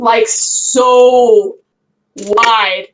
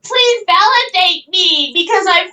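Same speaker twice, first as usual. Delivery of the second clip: mock-needy girl, pitched way up then easing down; needy whining; 5 semitones higher than usual. Mocking girls who fish for compliments, needy high whine. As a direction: whine theatrically for validation. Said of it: "I'm fucking needy".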